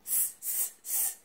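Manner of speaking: soft hissed monkey chatter; short voiceless tss noises; monkeys' tss. A narrator's reading. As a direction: mimic monkeys with quiet hisses. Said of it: "back at him and said".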